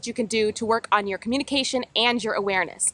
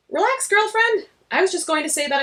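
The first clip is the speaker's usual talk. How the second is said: breezy friend; 7 semitones higher than usual. Acted. Friend reassuring Astrid.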